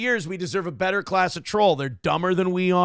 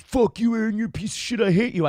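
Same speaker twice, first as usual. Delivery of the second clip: mock troll insult; 4 semitones higher, faster than usual. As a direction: spit it out like a cartoon troll. Imagined troll insulting him.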